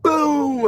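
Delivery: high boom falling away; imitated thunder boom. Storyteller doing the dramatic noise by mouth, imitated thunder boom. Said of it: "but I could do that".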